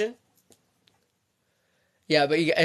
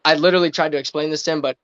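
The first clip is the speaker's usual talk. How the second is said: ordinary delivery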